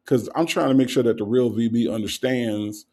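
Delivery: ordinary talk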